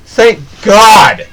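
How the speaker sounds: "thank God" overdone, high and loud; mock relief. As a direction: gush with hammed-up relief.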